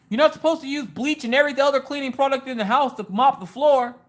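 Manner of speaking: high, loud imitation of fussing husband; mock scolding. Mimicking husband objecting to bleach, high mock scolding. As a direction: fuss in a high, exaggerated complaint. Acts out Mister Coffee scolding her.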